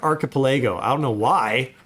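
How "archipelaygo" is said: archipelago said as arki-puh-LAY-go; habitual mispronunciation. Shown as a demonstration. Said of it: "I always grew up pronouncing it".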